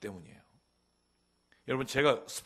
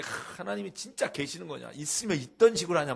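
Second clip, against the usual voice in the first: mock doubting deacon; 5 semitones higher, 6 dB louder than usual. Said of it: "막 이러면".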